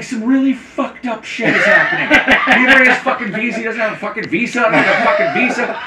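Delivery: high mock-frantic rant; high-pitched flustered tirade, not fully shouted; yelling. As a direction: imitate someone screaming on the way out. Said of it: "she's yelling, screaming".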